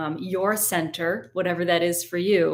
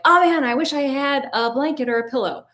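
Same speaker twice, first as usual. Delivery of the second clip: higher-pitched imagined whine of regret; mock regret; 7 semitones higher, 4 dB louder than usual. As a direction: voice a student's mock "if only" complaint. Imagined listener's regret during relaxation.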